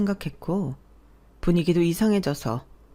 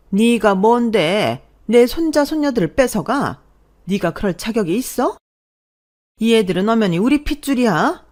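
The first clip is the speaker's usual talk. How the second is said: shouting mother-in-law; 5 semitones higher, 7 dB louder than usual. Acted. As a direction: scream at the daughter-in-law. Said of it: "다짜고짜 고함을 지르시며". (고함치는 시어머니)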